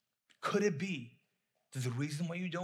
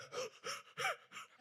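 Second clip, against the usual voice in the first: sharp gasps of hammed-up panic; acted-out hyperventilating; mock panicked gasps; 7 dB quieter than usual. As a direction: gasp as if bracing for bad news. Acts out panic before son's call.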